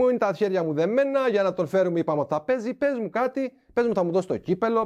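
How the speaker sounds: high, insistent retold appeal; re-enacted exasperated plea; exasperated pleading. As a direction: plead for any explanation, worked up. Retold plea to be told anything.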